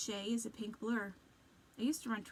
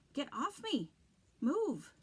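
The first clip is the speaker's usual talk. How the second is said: panicked higher shout; 6 semitones higher, 5 dB louder, slower than usual. Acted. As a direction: yell to get free. Pinned down, struggling to escape.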